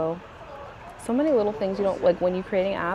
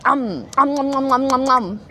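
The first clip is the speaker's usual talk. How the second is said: playful "um um" gobbling noises; pretend munching; 4 semitones higher, 4 dB louder than usual. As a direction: nibble noisily at a child's feet in play. Pretends to eat nephew's feet.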